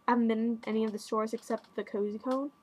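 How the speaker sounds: ordinary delivery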